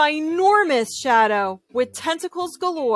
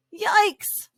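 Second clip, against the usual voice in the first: yikes; 10 semitones higher than usual. Acted. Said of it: "cried Joey Mackerel".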